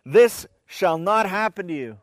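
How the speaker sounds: rebuking. A narrator's reading. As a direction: push back hard, insisting. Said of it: "began to rebuke him".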